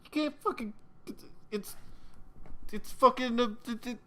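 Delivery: high, halting stammer of a scared captive; can't get the words out; stammering terror. Acted. Fred stammering to his interrogator, high scared stammer.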